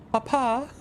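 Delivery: put-on high, pleading child calling Papa; whiny child. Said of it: "I start walking around going".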